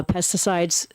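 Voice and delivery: normal talking voice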